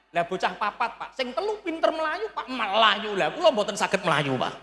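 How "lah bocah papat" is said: usual speaking voice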